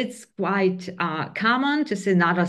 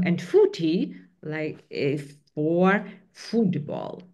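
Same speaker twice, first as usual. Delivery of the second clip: ordinary delivery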